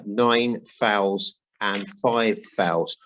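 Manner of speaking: usual speaking voice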